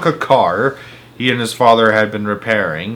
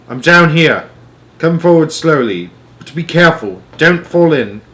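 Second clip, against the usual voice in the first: calling warning; 5 semitones higher, 5 dB louder than usual. A narrator's reading. Father warns from the pit.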